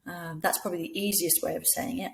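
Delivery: ordinary talk, nothing marked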